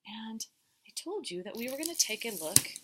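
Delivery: ordinary talk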